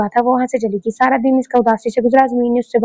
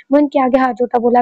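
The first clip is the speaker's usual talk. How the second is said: usual speaking voice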